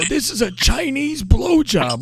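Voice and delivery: Razor. Retold or imitated. Storyteller mimics the woman announcing her ritual, high put-on rasp. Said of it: "her voice sounded like she gargled with razor blades".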